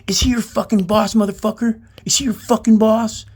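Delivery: high, mock-screamed challenge; imitated screaming. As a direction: mimic him yelling in someone's face, pitched well up. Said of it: "like, screaming at me".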